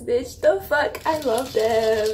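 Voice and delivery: ordinary talk, nothing marked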